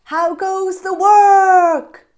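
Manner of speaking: high, loud, slow bossy call; lazy farmer calling out to the duck, high and loud. Acted. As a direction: call down lazily from bed.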